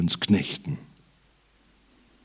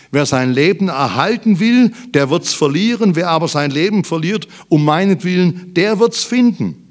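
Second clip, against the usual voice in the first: higher, forceful preaching with punched key words; eindringliche Predigt; 6 semitones higher, 6 dB louder than usual. Acted. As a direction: preach it urgently to the congregation. (eindringlich und kraftvoll gepredigt)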